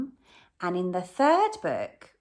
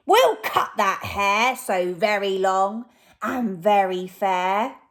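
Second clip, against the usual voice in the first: louder, plotting villain voice; scheming robber; 7 dB louder, slower than usual. Acted. Lanky Len telling Hefty Hugh the plan, scheming. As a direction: hatch the plan with sly glee. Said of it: "Said Lanky Len to Hefty Hugh".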